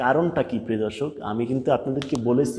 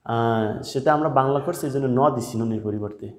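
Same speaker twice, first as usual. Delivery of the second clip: ordinary talk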